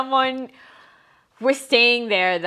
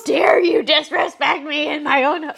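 mock outrage; 5 semitones higher, 5 dB louder than usual. Outrage at stranger in home.

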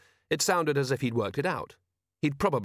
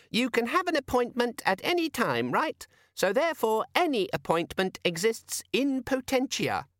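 very high and slowing, explaining; patient squeaky demon; 12 semitones higher than usual. A narrator's reading. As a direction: talk him through it like a tutor. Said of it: "said the demon patiently".

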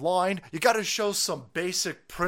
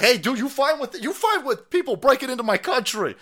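higher, louder mock challenge to his face; pretend confrontation; 10 semitones higher, 5 dB louder than usual. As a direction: call him out, loud and high. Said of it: "Be like".

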